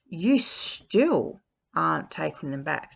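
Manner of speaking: usual speaking voice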